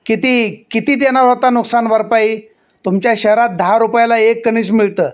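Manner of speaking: mock the offer with rising heat. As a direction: sarpanch angrily scoffing at the compensation. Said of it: "आवाज चढवून सरपंच तावात बोलले".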